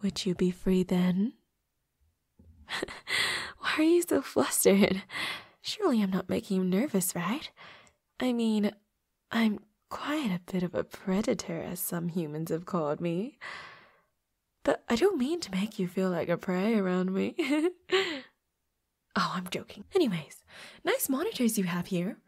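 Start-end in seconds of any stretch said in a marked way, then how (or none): 2.7-3.5 short, breath-only chuckle; amused chuckle. Acted. Teasing a flustered new roommate, amused chuckle. 17.4-18.2 short, high giggle; teasing giggle. Acted. Joking to a roommate about prey, teasing giggle.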